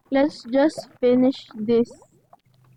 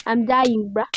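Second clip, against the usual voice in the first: usual speaking voice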